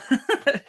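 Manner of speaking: sudden high giggle; high burst of laughter. Real, not acted. Laughing at own joke.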